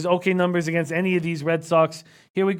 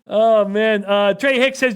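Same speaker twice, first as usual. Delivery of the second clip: ordinary talk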